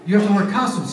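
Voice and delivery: high, pleading exasperation; exasperated host. Retold or imitated. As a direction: plead with them, fed up. Host exasperated at naked guests.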